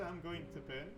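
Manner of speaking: usual speaking voice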